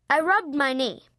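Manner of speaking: usual speaking voice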